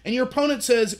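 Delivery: ordinary talk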